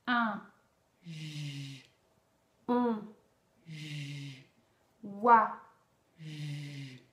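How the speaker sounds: vowel drill ending each time in a sustained "J"; vowel then j drill. Shown as a demonstration. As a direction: alternate a loud vowel and a low buzzed "J". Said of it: "je vais vous faire également les gestes".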